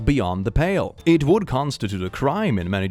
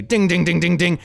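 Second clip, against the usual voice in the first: loud sing-song ding ding; bell imitation; 5 semitones higher, 5 dB louder than usual. Real, not acted. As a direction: ring it out like a bell.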